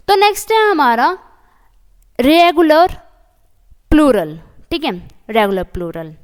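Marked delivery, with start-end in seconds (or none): 0.1-4.4 louder and higher topic heading; loud announcement. Shown as a demonstration. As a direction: announce the new topic to the class. Announcing new topic loudly. (ज़ोर से नया विषय बताना)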